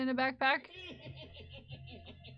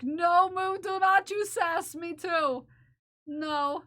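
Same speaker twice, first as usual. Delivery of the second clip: mock scolding; 5 semitones higher, 6 dB louder, slower than usual.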